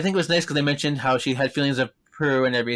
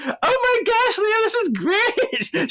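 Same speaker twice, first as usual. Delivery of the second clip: very high, drawn-out gushing; imitated giddy happiness; slower than usual. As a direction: put on an over-the-top happy squeal. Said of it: "she's much more happier".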